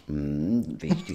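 doubtful, low hum then a mock-impressed wichtig; drily unconvinced; sceptical hm. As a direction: ironic. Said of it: "Dachte ich".

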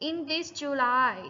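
slow, clear model line for students; slow model reading. Shown as a demonstration. Teacher reading aloud for students to repeat, slow and clear. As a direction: read it slowly for them to copy. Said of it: "repeat after me".

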